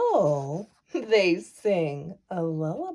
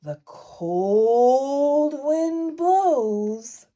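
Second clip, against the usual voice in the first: slow windy narration; 6 semitones higher, 5 dB louder, much slower than usual. A narrator's reading. Narrating howling wind.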